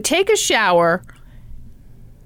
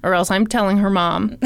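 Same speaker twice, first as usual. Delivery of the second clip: ordinary talk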